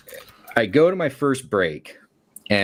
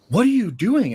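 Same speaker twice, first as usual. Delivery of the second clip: exasperated demand; 12 semitones higher than usual. Retold or imitated. Manager confronting, high and loud.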